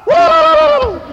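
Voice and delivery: high, full-voiced rallying yell with a whoop; yelling war cry.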